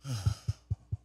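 quiet deep sigh from within; soft demo sigh. Shown as a demonstration. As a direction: breathe out a slow sigh. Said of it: "Anybody have a sigh before?".

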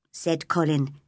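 plain reading voice

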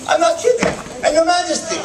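normal talking voice

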